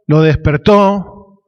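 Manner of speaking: ordinary talk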